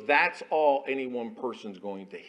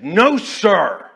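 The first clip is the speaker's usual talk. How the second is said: higher, loud, firm; firm reply; 8 semitones higher, 15 dB louder than usual. Retold or imitated. Chaplain answering his raging commander, loud and firm. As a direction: answer like a soldier standing firm.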